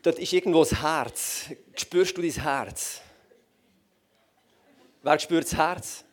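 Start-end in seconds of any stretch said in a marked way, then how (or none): none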